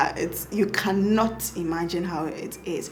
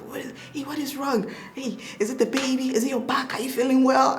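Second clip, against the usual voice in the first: husband jolted awake by his wife's crying, anxious and higher; worried husband; 6 semitones higher than usual. Retold or imitated. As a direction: imitate the husband waking up alarmed. Husband woken by crying wife.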